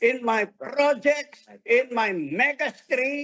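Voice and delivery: preaching declaration. Shown as a demonstration. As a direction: press the words home in faith. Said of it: "Come on, say it". (masigasig na pagpapahayag)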